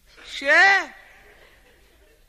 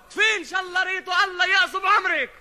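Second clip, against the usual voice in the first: shouted curse, higher and louder; exasperated mom scolding; 9 semitones higher, 5 dB louder than usual. Acted. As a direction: yell the curse down the phone.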